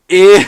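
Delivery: very loud, high cringe squeal; cringing squeal. Acted. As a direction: let out a mock-disgusted wail. Cringing reaction, acted.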